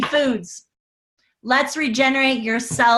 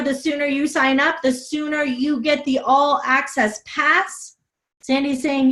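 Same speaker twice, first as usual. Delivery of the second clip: ordinary talk, nothing marked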